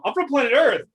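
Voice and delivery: mock earnest goofball declaring his home planet; goofy Riddle impression. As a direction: blurt it like a cheerful goof. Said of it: "first he goes like".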